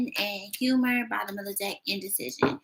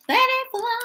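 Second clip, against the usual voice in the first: playful; 5 dB louder than usual. Acted. Playful send-off to viewers.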